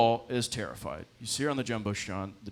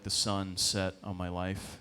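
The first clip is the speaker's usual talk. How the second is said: ordinary talk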